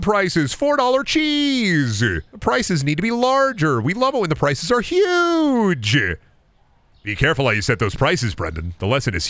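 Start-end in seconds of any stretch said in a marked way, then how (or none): 0.5-5.9 high, drawn-out gleeful crowing; hammed-up price hype. Shopkeeper gloats over premium prices, hammed-up hype. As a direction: stretch the last word out high and long. Hyping his store's prices.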